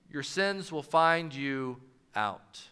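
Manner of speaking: the whole verse said low, soft and slow, the weight on "find"; slow, low ending. Shown as a demonstration. Preacher's closing take on the verse, low and slow. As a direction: let it sink in quietly. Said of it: "No matter where you place the emphasis".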